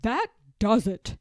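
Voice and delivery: a loud, fed-up declaration. Acted. George gives up on Martha's meddling, loud and fed up. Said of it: "said George".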